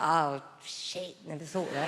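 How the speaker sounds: low, quiet deflated aside; mock sinking realisation. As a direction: drop down into rueful, acted dismay. Realising own naming mistake.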